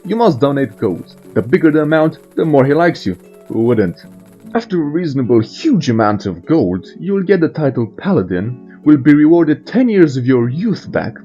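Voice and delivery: ordinary talk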